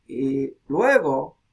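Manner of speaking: ordinary talk, nothing marked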